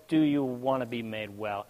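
low, quiet questioning; voice of God. Retold or imitated. God asking a third time, quiet and solemn. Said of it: "He says".

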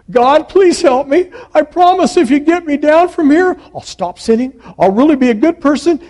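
high, loud begging; frantic pleading. Acted. Jack promising God anything to get down, frantic pleading. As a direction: bargain desperately, rushing by the end.

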